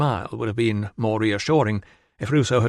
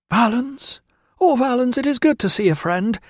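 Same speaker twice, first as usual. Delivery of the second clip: high, louder relieved cry of joy; overjoyed to see a friend; joyful greeting; 13 semitones higher, 4 dB louder than usual. Acted. Woman greeting her friend at the gate, joyful.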